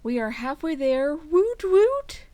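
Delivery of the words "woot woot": high, loud happy cheer; excited cheer. Real, not acted. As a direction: cheer it out like a fan. Cheering about reaching halfway.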